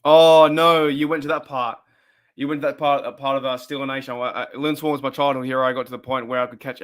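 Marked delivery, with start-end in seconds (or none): 0.0-0.9 a sudden loud groan of dismay; loud, real dismay at the topic. Real, not acted. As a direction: caught off guard, loud and pained. Dreading a topic the chat raised.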